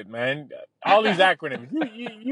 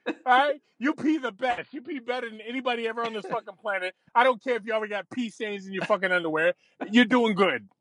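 higher, eager groveling dog; 4 semitones higher than usual. Pretend. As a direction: beg and flatter like a desperate pup. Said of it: "the poodle at the fucking pound".

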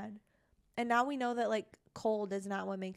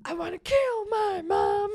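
put-on much higher and slow, a whimpering little boy; imitating a creepy small boy with a teary, much higher voice; creepy little kid voice; 11 semitones higher, 6 dB louder than usual. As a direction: whine it like an eerie small child. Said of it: "I can't do this voice".

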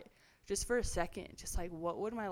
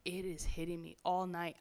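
ordinary talk

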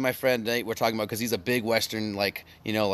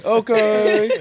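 high, loud imitation of calling back; mock called-out reply; 10 semitones higher, 9 dB louder than usual. Imagining Lewis answering from inside, mock shouted reply. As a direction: call it out loudly, mimicking him through laughter.

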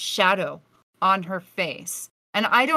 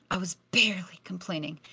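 whispered repeat; 2 semitones lower, 6 dB quieter, faster than usual. Shown as a demonstration. Repeating herself to the audience in a whisper. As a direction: whisper "I was barely complaining", no voice at all. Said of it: "'cause I was barely complaining".

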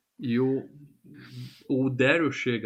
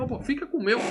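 much higher, overly generous host voice; high eager offer; 12 semitones higher, faster than usual. Retold or imitated. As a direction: chirp it up high like a fawning hotel owner. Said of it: "O cara fala".

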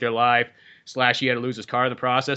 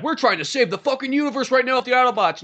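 raised-pitch mock protest; exasperated pleading; 8 semitones higher than usual. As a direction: plead the urgent cause, voice pitched up. Acting Sam's side of an argument.

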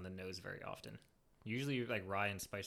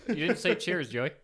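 loud call-out; 7 semitones higher, 14 dB louder, slower than usual. Real, not acted. Calling out friend for skipping cheers.

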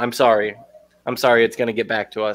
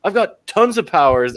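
mock indignant; 8 semitones higher than usual. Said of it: "He's like".

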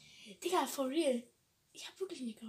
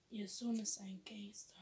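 slow whispered punchline; 5 semitones lower, 10 dB quieter than usual. Acted. Rapping along to a prison song, whispering the punchline. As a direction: hush it like a secret boast. Rap lyric line whispered.

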